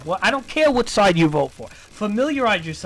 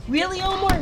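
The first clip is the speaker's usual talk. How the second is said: a high, incredulous "Really, Omar?"; mock disbelief; 11 semitones higher than usual. Streamer scolding Omar, high mock disbelief. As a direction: pitch it up in disbelief. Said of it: "it's like".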